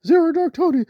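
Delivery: high, whiny mock sob voice; mock whining. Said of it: "when you're crying and whining, say".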